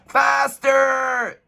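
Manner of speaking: high, loud urging shout; cried. A narrator's reading. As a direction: push the horses on. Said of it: "they cried".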